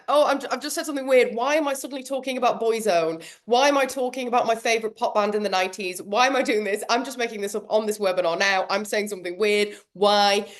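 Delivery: rushed, spiralling self-questioning; flustered embarrassment. Acted. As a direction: blurt it out in a fast, mock-embarrassed ramble. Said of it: "flash of embarrassment".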